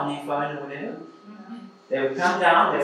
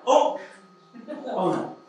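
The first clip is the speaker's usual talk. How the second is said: playful fatherly praise; 5 semitones higher than usual. Retold or imitated. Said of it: "I'm like".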